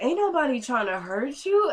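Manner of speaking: imitated psychotic sing-song. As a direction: lilt it like a taunting singsong. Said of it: "like, a sing-song".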